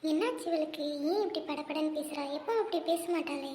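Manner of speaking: hushed, close inner thought. Acted. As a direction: think it silently to yourself. Friend wonders silently about her.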